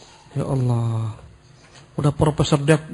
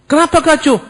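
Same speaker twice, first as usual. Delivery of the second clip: alarmed brother; 13 semitones higher, 6 dB louder than usual. Retold or imitated.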